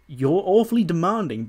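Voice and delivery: mildly exasperated, lilting jab; pointed remark. Acted. [name] reacts to Roode's demand, acted.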